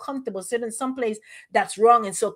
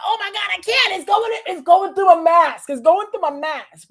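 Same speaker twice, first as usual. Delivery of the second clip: high, far-off alarmed voice, growing louder; panicking that smoke gets through her mask; choking on smoke; 9 semitones higher, 5 dB louder than usual. Retold or imitated. Retelling smoke-filled restaurants to the congregation, high alarmed whine. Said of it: "I was like".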